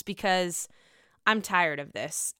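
usual speaking voice

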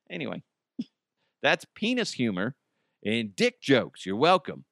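normal talking voice

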